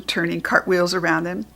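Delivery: ordinary delivery, nothing marked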